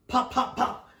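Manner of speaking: retelling the father's punches, imitated pops; punch sound effects. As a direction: mimic each blow landing. Mimics punches landing.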